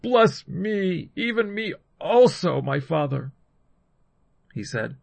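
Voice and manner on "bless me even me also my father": high, louder plea, easing at the end; understated bitter cry, pleading. A narrator's reading. Esau begging his father for a blessing, bitter pleading cry. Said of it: "cried with an exceedingly great and bitter cry".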